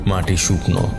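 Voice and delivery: ordinary delivery, nothing marked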